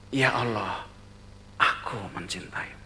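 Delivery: soft, breathy half-whisper of devotion; hushed prayer. Retold or imitated. The traveler murmuring his love to God, hushed whisper.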